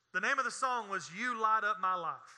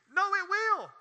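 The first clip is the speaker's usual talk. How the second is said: urgent warning, raised pitch, building list; full-force preaching; 5 semitones higher, 5 dB louder than usual. Real, not acted. Preacher warns against trying drugs, full-force preaching. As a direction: build hard to a loud verdict.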